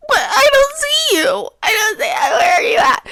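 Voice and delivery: very high, frantic squeal; retold panic. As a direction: wail it into the phone, hammed up. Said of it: "I'm freaking out".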